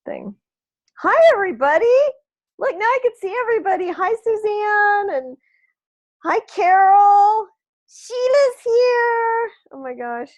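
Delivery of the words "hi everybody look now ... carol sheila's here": excited greeting. Real, not acted. Greeting the whole group on call.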